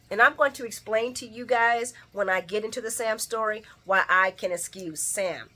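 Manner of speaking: usual speaking voice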